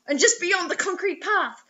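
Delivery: ordinary talk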